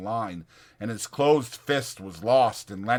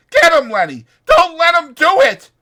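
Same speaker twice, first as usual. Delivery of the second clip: very high, loud urgent yell; 14 dB louder than usual. Acted. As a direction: urge him on at the top of your voice.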